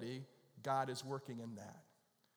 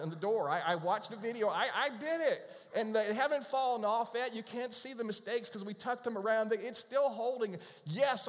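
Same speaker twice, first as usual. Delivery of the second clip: higher, louder, overeager boasting about car repairs; mock bragging; 7 semitones higher, 5 dB louder than usual. Pastor imagining bragging to the parts clerk, eager and loud. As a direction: puff yourself up like an amateur mechanic.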